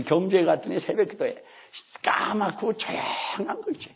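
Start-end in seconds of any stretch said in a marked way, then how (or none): 2.0-3.9 slow, hushed, drawn-out words. Retold or imitated. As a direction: draw it out softly to paint the silence. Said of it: "소리가 없어". (숨죽인 듯 길게 늘여서)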